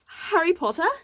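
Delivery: Harry Potter in a hammy fake British accent. Real, not acted. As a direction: put on a bad British accent. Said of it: "that crappy fake British accent".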